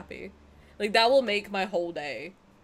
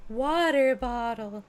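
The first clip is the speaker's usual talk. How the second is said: slow, drawn-out American "water bottle"; put-on American pronunciation, stretched out; American accent; 3 semitones higher, slower than usual. Shown as a demonstration. Exaggerated American demo of accent.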